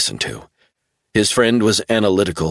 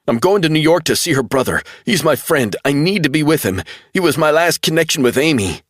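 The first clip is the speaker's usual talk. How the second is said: much higher, urgent pleading; desperate insistence; 8 semitones higher than usual. A narrator's reading. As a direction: make him believe you. Travell pleading.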